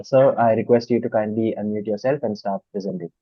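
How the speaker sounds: usual speaking voice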